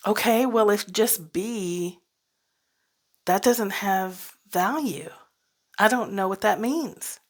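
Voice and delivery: ordinary talk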